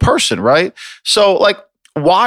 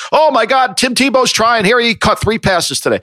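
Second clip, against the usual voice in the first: mocking TV hype; 6 semitones higher than usual. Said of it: "all we'll see on SportsCenter is".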